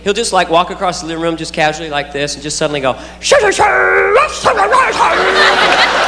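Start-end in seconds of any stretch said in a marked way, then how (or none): 3.2-6.1 high, drawn-out goofy wailing noise; imitated child's wild gibberish; silly four-year-old noises. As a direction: burst into nonsense sounds like a silly kid. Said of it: "will just be suddenly silly".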